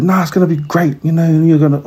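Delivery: encouraging teacher. Retold or imitated. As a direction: talk her into it cheerfully. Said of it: "was like".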